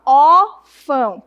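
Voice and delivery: ÓRFÃO with a loud, stretched first syllable; first syllable of "órfão" strongly stressed. Shown as a demonstration. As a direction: punch the open ÓR of "órfão", then drop to ão. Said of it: "a sílaba tônica é o ó".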